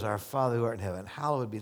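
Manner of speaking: fast rote recital; rattled-off prayer. Shown as a demonstration. Pastor mocking a rushed rote prayer, fast. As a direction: rush through it like a rote prayer. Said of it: "First thing you do is".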